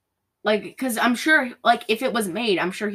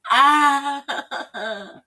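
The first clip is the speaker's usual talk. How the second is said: higher, louder mock cries of pain; mock pained cry; 6 semitones higher, 6 dB louder than usual. Imitating Sharon thinking her water broke, mock pained cries. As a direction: ham up a woman's labour yelps. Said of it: "She's like".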